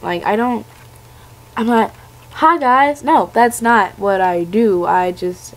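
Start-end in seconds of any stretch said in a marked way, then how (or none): 2.3-3.0 higher, louder play voice; a hammy high greeting to viewers; mock girly greeting. Mock youtuber doll voice.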